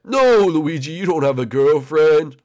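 loud, drawn-out cartoon ghost; emphatic ghost buddy; teasing ghost friend. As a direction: call out the correction in a ghostly voice. Ghost Blimpy corrects Luigi.

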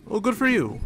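high, bright sarcastic voice. Acted. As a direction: say it with sarcastic cheer. Voicing game dialogue sarcastically.

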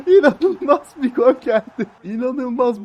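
laughing disbelief. Acted. Laughing after goal.

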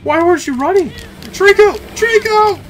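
high, loud frantic yells for help; real panic, shrieking the name; panicked screaming. Real, not acted. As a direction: scream for the beast to save you. Chased by armor, calling companion.

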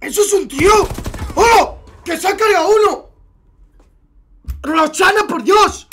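high, loud burst of amazement; thrilled, disbelieving outcry; excited shock. Real, not acted. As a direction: exclaim like a stunned spectator. Excited reaction to a kill in game.

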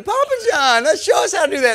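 very high, loud child imitation; excited grandkids. Grandkids running to fallen Papa John, high excited kid voice. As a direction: call out like kids running up eager to try. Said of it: "all the kids come running".

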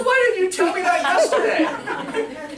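high, loud, slow protest through a laugh; student protesting. Retold or imitated. Student complaining to the speaker, loud laughing protest. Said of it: "He goes".

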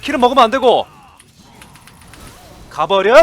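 loud urgent yelling; shouting orders. Real, not acted. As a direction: bark the orders out.

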